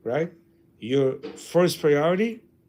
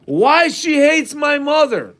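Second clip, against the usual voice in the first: exasperated complaint; 8 semitones higher, 7 dB louder, slower than usual. Retold or imitated. Quoting husband's complaint.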